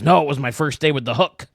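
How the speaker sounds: loud pirate delivering the punchline. Retold or imitated. Pirate gives the punchline, loud and hammy. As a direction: land the gag with gusto. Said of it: "He says".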